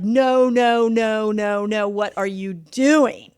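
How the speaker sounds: long, drawn-out string of refusals; alarmed protest. Acted. Host voices a body resisting boldness, drawn-out alarmed protest. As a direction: protest in dismay, stretching it out slowly. Body's panicked protest, acted out.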